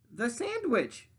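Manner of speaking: high, slow pleading; begging pig. Acted. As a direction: drag it out, begging. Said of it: "begged Pinkerton".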